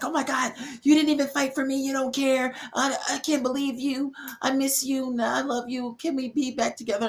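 high-pitched mock panic, pleading; panicking girlfriend. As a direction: imitate a frantic girlfriend begging him back. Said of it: "they have so much anxiety and they're panicking".

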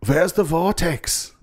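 high, slow mock German accent; old German guy. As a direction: imitate a German elder, stretching each word. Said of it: "and he goes".

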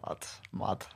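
breathless hushed lad, mad; hushed disbelief at Gerrard's call; awed whisper. Real, not acted. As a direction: whisper it, still amazed. Awestruck at Gerrard wanting him.

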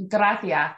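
"Gracias" lisped as "grathias"; Castilian lisp. Shown as a demonstration. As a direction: say "Gracias" with a th sound for the c. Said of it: "pronounce some things with, like, a lisp".

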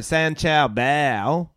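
slow, drawn-out Chinese accent take; mock Chinese accent, slow. Shown as a demonstration. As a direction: say the name slowly in a Chinese accent. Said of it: "or am I going".

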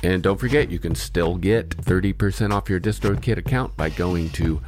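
upbeat sponsor read over music. Acted. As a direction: read it like a podcast ad. Sponsor ad reads.

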